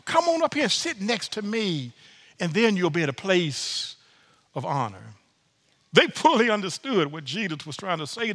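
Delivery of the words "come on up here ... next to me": higher, warm welcoming host voice. Retold or imitated. Preacher voicing a host welcoming a humble guest, higher and warm. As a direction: call the humble guest up kindly. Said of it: "your host will say".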